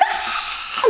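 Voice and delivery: very high mock shriek; imitated screaming. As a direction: imitate a toddler shrieking in pain, not full volume. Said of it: "like this, she's screaming".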